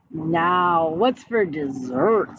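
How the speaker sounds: a greedy bear asks about dessert, low and slow; big bear. A narrator's reading. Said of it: "said the bear".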